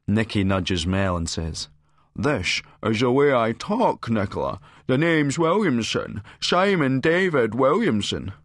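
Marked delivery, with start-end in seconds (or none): 2.1-8.3 slow, high, exaggerated mimicry; hammed-up drawling send-up of an affected voice; mocking imitation. A narrator's reading. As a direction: parody his slow speech, slurring and stretching it. Nikki mocks his way of talking.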